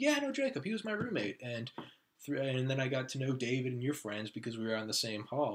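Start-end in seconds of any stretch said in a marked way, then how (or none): 0.0-1.4 higher mock friend voice; imitating friend. Cal explains he knows Jacob, voiced higher. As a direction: toss it off like an eager friend.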